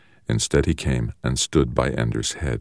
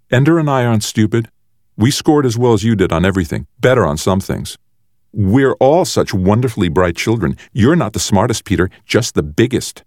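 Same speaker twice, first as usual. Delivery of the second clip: lightly voiced sister, higher pitched; defiant sister; 6 semitones higher than usual. A narrator's reading. Sister tells her big brother he's not the smartest, defiant. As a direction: talk back to a bully, pitched up. Valentine stands up to Peter.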